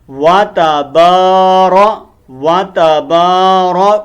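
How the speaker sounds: واتا بارا drawn out very slowly, each syllable long; slow syllable drill. Shown as a demonstration. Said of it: "با زبر الف با". (واتا بارا کو آہستہ ہجوں کے ساتھ پڑھیں)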